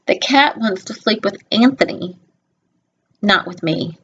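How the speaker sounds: ordinary delivery, nothing marked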